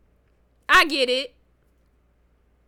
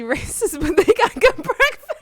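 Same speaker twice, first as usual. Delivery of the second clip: higher voice breaking into real laughter; laughing while talking; 6 semitones higher, 3 dB louder than usual. Real, not acted. Joking about Cracker Barrel breakfast, laughing. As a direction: laugh through the words.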